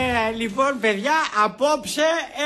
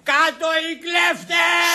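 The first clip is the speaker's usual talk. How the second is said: put-on high, drawn-out drunken street chant; slow rallying cry; shouting a slogan; 5 semitones higher, slower than usual. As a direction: shout it up at the windows. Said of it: "θα φωνάζω".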